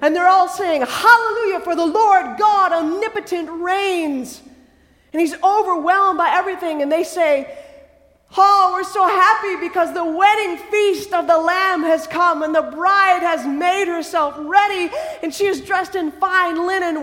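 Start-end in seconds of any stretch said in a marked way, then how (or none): 0.8-4.4 high, loud hallelujah proclamation; thunderous praise. Acted. Heavenly multitude praising God, thunderous. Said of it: "it's like thunder peals". 8.4-17.0 high, loud rush of joy, easing slightly at the end; overjoyed. Acted. Heavenly multitude announcing the wedding feast, overjoyed.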